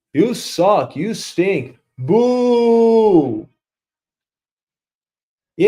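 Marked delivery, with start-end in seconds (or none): 2.0-3.5 a loud hammed-up boo; mock boo. Booing the boss, a loud mock boo. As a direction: jeer at him like a crowd.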